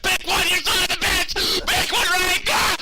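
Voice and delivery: high, loud frantic yell; inner scream. Retold or imitated. Silently screaming at the slow clerk, frantic high yell. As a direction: scream it in your head at him. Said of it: "In my head, I'm like".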